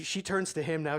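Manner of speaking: usual speaking voice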